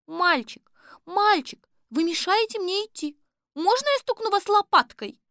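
put-on high, prim little child. A narrator's reading. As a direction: sound like a well-mannered kid. Polite sour kindergarten kids.

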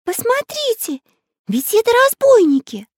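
put-on very high, startled girl's cry; piping alarm at the sight. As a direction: warn everyone in a high-pitched voice. Girl alarmed on seeing bandits.

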